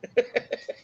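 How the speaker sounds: a short high burst of laughter. Real, not acted. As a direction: laugh fondly at the naughty dog. Laughing at her dog's antics.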